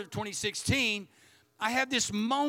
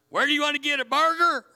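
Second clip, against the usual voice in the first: loud, high Oklahoma holler; hammed-up Oklahoman yell, pitched high; Oklahoma accent; 5 semitones higher, 7 dB louder, faster than usual. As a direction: put on a broad Oklahoma accent and belt it out. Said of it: "British people sound smarter than Oklahoma people".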